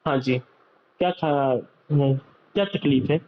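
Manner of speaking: low, unhurried doctor asking a patient questions; calm doctor. Retold or imitated. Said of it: "ایسے بات کرتے ہیں نا".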